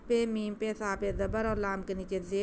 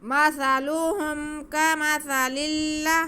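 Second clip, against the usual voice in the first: slow, high, loud chant of مثلهم كمثل; tajweed recitation; 6 semitones higher, 8 dB louder, much slower than usual. Shown as a demonstration. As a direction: model the words for the student to repeat. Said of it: "سیدھے سیدھے لفظ ہیں یہ".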